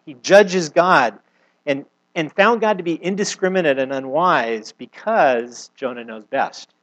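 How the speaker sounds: slow, weighted preaching; slow emphatic point. Real, not acted. Preacher pressing the lesson on Jonah's pride, slow and weighted. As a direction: lay each word down slowly for weight.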